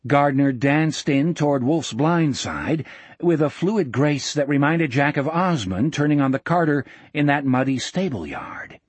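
ordinary delivery, nothing marked

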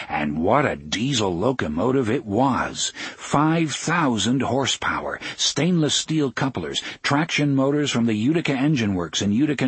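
plain reading voice

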